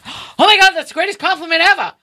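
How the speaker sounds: high, loud, fast excited squeal; thrilled gush. Retold or imitated. Host reliving the compliment, squealing high and fast. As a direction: gush like you just got the best compliment of your life.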